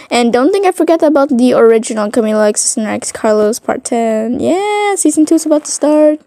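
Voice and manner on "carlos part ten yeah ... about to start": ordinary delivery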